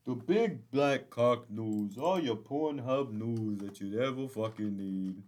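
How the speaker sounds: slow, loud announcer voice; mock news announcer. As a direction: announce it like a cheesy newscaster.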